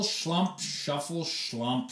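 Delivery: plain reading voice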